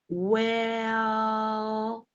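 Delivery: "well" stretched long and level; drawn-out well. Shown as a demonstration. Teacher showing students a hesitant, drawn-out "well". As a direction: hold the vowel of "well" out slowly. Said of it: "notice how the vowel is drawn out".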